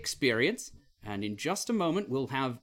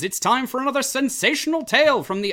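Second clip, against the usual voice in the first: loud, higher pulp-serial announcer; 8 semitones higher, 9 dB louder than usual. Acted. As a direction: hype up the adventure. Show intro announcer.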